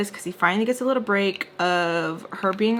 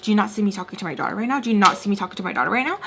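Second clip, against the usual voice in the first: rapid-fire indignant demand; upset; much faster than usual. Retold or imitated. Father upset at an interrupting waitress, indignant. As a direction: imitate an upset father, fast and rising at the end. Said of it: "Mister Peach gets upset".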